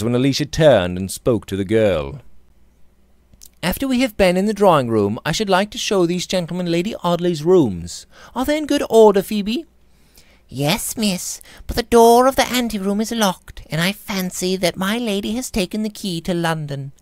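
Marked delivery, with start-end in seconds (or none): 3.6-9.6 put-on higher young lady's voice, brisk at first; young lady to maid. A narrator's reading. Alicia asks the maid. 10.5-16.9 a deferential maid explaining; timid maid. A narrator's reading. Maid Phoebe answering.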